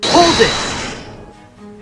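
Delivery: high, forceful courtroom shout; shouted interruption. Acted. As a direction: cut in with a sudden loud yell. Attorney interrupts testimony with objection.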